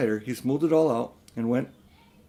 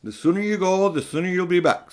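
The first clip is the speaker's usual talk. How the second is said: bright, raised breezy uncle; cheerfully; 7 semitones higher, 4 dB louder than usual. Acted. Uncle breezily answering Diggory, cheerful. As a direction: brush it off cheerily. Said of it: "said Uncle Andrew cheerfully".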